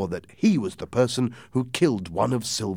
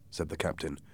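ordinary delivery, nothing marked

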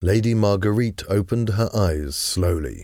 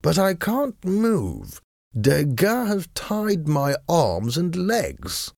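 high-pitched, languid complaint; bored drawl; 10 semitones higher, slower than usual. A narrator's reading. Tied-up husband explaining to his wife, bored drawl. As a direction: drawl the words out with big swoops in pitch. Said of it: "Sir Percy said in his bored voice".

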